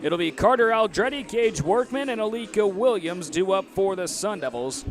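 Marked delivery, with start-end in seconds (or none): none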